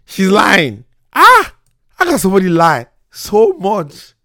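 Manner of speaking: high, loud outburst of disbelief; indignant outcry. Real, not acted. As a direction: protest loudly, pitch leaping up. Outraged after she hangs up.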